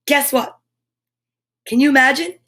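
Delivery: plain reading voice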